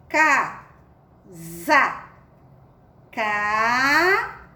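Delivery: "casa" em duas sílabas, "ca... za", com S soando Z; leitura silabada. Shown as a demonstration. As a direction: leia "casa" separando e alongando: "caaa... za". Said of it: "Viu como ficou diferente?". ("casa" silabado devagar, com som de Z)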